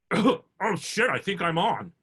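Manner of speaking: the late actor realising his mic is on; mic-caught panic. Retold or imitated. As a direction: blurt it as the mic goes live. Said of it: "then you hear".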